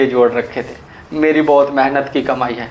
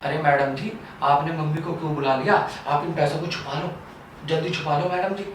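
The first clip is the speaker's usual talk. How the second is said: hushed urging; 2 semitones higher, 7 dB quieter than usual. Acted. Urging her to hide money.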